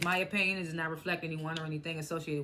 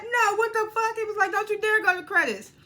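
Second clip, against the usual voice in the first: high, loud mock outrage; furious fan shouting at the credits; outraged yell; 13 semitones higher, 7 dB louder than usual. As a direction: yell at the screen in disbelief. [name] outraged at season cliffhanger.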